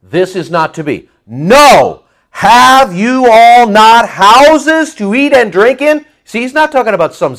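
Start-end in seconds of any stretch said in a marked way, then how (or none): 1.2-2.0 No thrown out loud and long; emphatic NO. Acted. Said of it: "That's the emphasis". 2.3-6.0 loud, slow, high-pitched scolding question; forceful rebuke. Acted. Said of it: "Paul is sticking his finger right".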